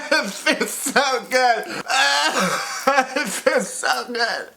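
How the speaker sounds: high, slow, laughing fake cheer; laughing through pain. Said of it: "saying all this weird stuff like".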